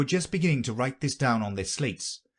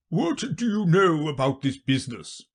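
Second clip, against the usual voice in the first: loud, higher royal questioning; king's raised, imposing voice; pompous king; 7 semitones higher, 4 dB louder than usual. Acted. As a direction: question her as a storybook king. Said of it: "the king said to Alice".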